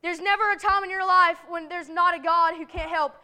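ordinary delivery

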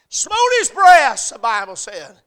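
high, loud, drawn-out preacher's cry; full-throated revival preaching; preaching at full force. Acted. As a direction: shout the sinner's prayer to the rafters. Preaching climax, publican's prayer.

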